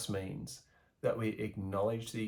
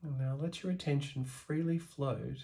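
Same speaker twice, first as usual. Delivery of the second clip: guided meditation; 5 dB quieter than usual. Acted. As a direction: ease the listener into calm, letting the voice sink softer. Guided meditation practice begins.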